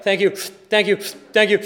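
fake thanks. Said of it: "It'll become fake".

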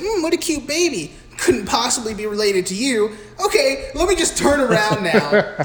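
high, singsong fake-sweet voice; mocked clueless nurse. Nurse admiring Michael's baby picture, mock oblivious and high. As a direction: imitate an oblivious nurse, hammed up. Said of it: "and she's like".

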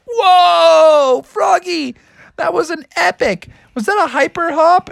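high, awestruck cheer; amazed parents. Acted. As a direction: cry out in wonder, pitched high.

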